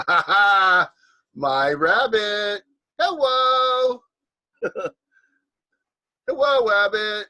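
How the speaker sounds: loud, high, stretched-out hello; laugh, then sing out the greeting loudly; playful delighted greeting. Pretend.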